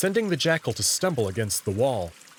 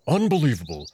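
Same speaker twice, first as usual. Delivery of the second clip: angry muttering; 3 dB louder than usual. Acted. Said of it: "muttering under his breath".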